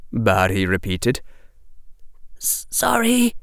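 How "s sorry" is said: put-on high, stammering scared boy's apology; a frightened child apologising; timid young boy. A narrator's reading. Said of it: "whispered the eleven-year-old boy".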